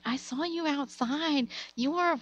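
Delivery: high, drawn-out mom imitation; a hint of gloating mother; lightly voiced teasing mom. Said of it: "she's like".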